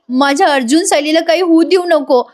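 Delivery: high, plaintive pleading; mock tearful prayer. Kalpana prays to the goddess for her children, mock tearful. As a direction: imitate a weeping mother begging, without real sobs. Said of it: "कल्पनाताई रडत असतात".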